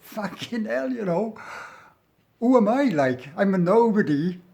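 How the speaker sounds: high, rising disbelief, getting loud; incredulous. Retold or imitated. Acted startled thought at armed guards.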